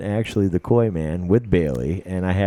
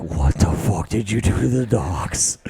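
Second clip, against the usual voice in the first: hushed, slow, breathless demand; whispered accusation. Retold or imitated. Host confronting his buddy about the dogs in a whisper. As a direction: hiss the question at him.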